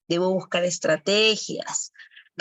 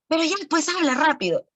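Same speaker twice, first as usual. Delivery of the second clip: much higher, pushy hurry-up; rushing a child who can't talk; impatient pressure; 9 semitones higher, faster than usual. Retold or imitated. (apurando con impaciencia, voz mucho más aguda)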